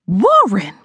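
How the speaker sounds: mother blurts out the name with mild excitement; a touch of eager news in the name; excited mother. Acted. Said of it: "she said excitedly".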